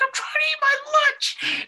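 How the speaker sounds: high-pitched squeaky whine; whiny annoyed voice. Retold or imitated. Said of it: "He's like".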